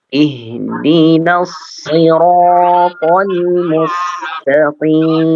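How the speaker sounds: slow chanted recitation of Iyyaka na'budu; Quran recitation. Shown as a demonstration. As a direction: recite slowly and melodically. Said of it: "teacher models recitation".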